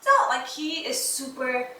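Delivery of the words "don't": put-on high, insistent little-boy voice, much higher; imitating her son. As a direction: mimic a kid telling a parent off, pitched way up. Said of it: "he'll be like".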